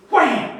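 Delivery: loud, high-pitched "wham"; sudden exploding impact word; imitated hammer blow. Hammer hitting the thumb while fence-building, loud imitated whack. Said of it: "And I hit my thumb".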